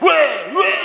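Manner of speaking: loud mocking yell of weh weh; mock shout. Mocking a worshipper who yells in church, loud mock shout. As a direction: bark it like a rowdy worshipper. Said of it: "Terus teriak".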